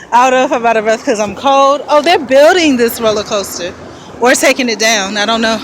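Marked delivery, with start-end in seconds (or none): none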